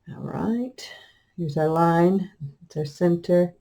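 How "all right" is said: whispering. Real, not acted. Whispered aside while working.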